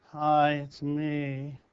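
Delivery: timid entrance. Shown as a demonstration. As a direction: shrink as you say it, insecure. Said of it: "Confidently or like this?".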